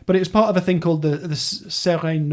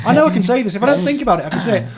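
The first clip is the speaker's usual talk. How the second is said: loud, higher, frustrated with yourself; self-exasperated; 8 semitones higher, 6 dB louder, much faster than usual. Real, not acted. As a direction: burst out, determined to get it right. Frustrated at fumbling the French.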